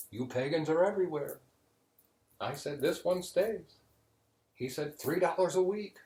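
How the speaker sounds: the pagan priest proudly retelling the debate. Acted. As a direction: lay out each line like a winning hand.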